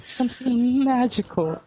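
high, lilting fairy-tale awe; mock Disney wonder. Co-host teasing the film's Disney ending, mock wonder. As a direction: put on exaggerated wonder, pitched high.

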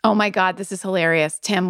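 usual speaking voice

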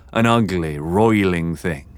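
ordinary delivery

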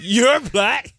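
high, emphatic imitation of a coach; acted movie outburst. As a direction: cry it out like a film coach. Acting out a coach's film speech.

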